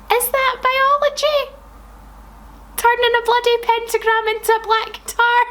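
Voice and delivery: high, disbelieving question; baffled, can this be real; incredulous. Real, not acted. Questioning the fic's idea of biology, high disbelief.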